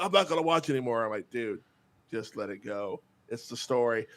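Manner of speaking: normal talking voice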